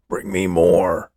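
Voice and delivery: slow, drawn-out demand. A narrator's reading. Greedy old man orders a helper to fetch food, demanding. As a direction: demand it slowly, stretching the words. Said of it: "he demanded".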